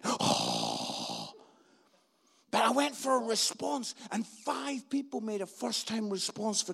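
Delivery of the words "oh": low, breathy whoosh; tumbleweed wind noise. Retold or imitated. Tumbleweed passes the stranded comic, imitated whoosh. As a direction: blow like wind across an empty stage.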